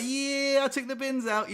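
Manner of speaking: proud, high-pitched brag; gloating boyfriend; smug. Pretend. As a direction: crow about it, pitch up high. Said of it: "And I'd be like".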